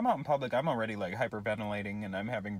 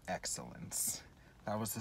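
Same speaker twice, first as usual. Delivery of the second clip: hushed, breathy reveal of one word; whisper; 3 semitones lower, 10 dB quieter than usual. Pretend. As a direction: whisper it like a secret. Jokey reveal after burp.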